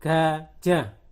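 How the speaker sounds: loud, spaced-out syllables; teacher's reading demo. Shown as a demonstration. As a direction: read each word out in separate, firm syllables. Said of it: "bisa membaca tulisan di bawahnya".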